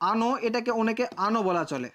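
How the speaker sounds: usual speaking voice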